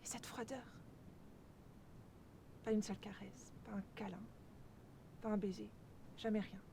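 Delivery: very soft, almost to oneself; quietly wounded listing; hushed reproach. Acted. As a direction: let the voice sink right down. Second take, hurt reproach.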